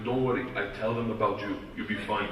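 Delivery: put-on low, soft Russian accent; Igor. Russian gangster reassuring his American friend, low accented voice. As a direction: reassure quietly in broken Russian-accented English. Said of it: "Igor pulls me aside".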